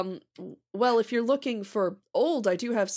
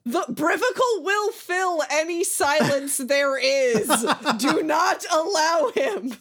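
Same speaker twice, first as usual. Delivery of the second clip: dramatic warning; 7 semitones higher, 4 dB louder than usual. Acted.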